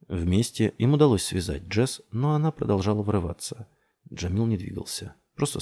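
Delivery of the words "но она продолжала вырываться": ordinary delivery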